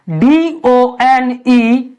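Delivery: letters spelled very slowly; slow spelling. Shown as a demonstration. Teacher spelling done letter by letter for pupils. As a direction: drag out each letter of the word. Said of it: "इसको हम क्या पढ़ेंगे?".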